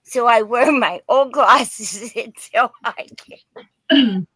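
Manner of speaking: real laugh breaking into the words; laughing while talking. Real, not acted. Seller telling viewers about puppy and glasses, laughing. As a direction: laugh through the end of the sentence.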